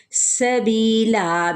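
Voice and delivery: سبیلا very slow, its س hissed sharp like a whistle; whistled seen. Shown as a demonstration. Teacher modelling the whistling seen for students, slow recitation. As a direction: stretch سبیلا and let the س whistle. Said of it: "سین پہ سیٹی کو واضح کریں".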